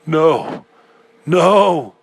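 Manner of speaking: high, loud rising cries of no; anguished yell. A narrator's reading. Man hearing the dark voice, yelling in anguish. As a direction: cry out in anguish. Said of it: "I yelled in anguish".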